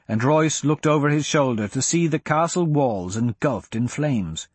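plain reading voice

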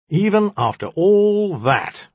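high, slow, drawn-out reproach; remorseless. A narrator's reading. Djinni keeps reproaching the magician, remorseless. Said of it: "I continued remorselessly".

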